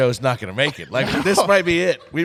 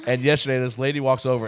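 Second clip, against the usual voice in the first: ordinary talk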